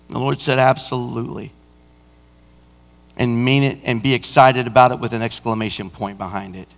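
low, soft, weighted pulpit delivery; subdued, pondering sermon voice; hushed preaching. Acted. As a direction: drop low and let each phrase sink in.

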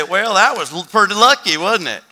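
loud, high, lilting doubter; exaggerated dismissive tone; mock sceptic. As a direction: mimic someone brushing it off as chance. Voices an imagined sceptic.

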